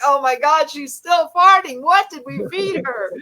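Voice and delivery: rising into a high incredulous outcry; retold disbelief. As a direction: act out exasperated disbelief at what you heard. Retelling hours of watching footage.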